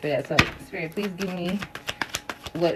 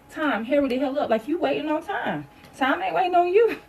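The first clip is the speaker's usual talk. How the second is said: high-pitched, playful impatient outburst; mock impatience; 8 semitones higher than usual. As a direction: hurry time along, voice climbing at the end. Acts out impatience with time.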